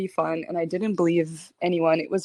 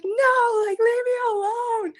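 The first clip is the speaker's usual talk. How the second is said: retold scared scream; 14 semitones higher than usual. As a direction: shriek it like a scared kid fleeing a monster. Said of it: "And I started screaming".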